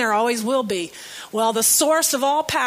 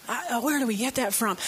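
softer, quicker repeat to herself; quiet aside; 3 semitones lower, 7 dB quieter, faster than usual. Real, not acted. Preacher musing to herself before the congregation, softer and quicker. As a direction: mutter it again under the breath, faster.

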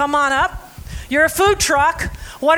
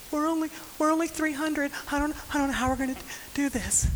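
mock timid; 11 dB quieter, faster than usual. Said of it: "He doesn't say".

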